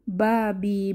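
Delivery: normal talking voice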